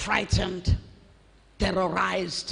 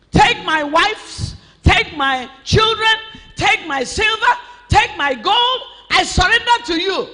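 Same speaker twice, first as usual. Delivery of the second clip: desperate shouted surrender; 7 semitones higher, 4 dB louder than usual. Acted. Preacher acting the king's surrender.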